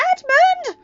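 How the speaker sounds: very high, loud shocked cry; sharp reproachful shout. Acted. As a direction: yell his name to stop him. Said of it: "shouted Lucy".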